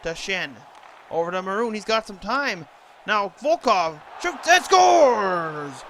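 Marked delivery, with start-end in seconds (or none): 3.1-5.8 roaring goal call, high and loud; booming prize announcer. Real, not acted. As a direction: belt out the goal like a stadium announcer.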